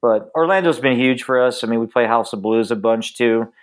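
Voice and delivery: normal talking voice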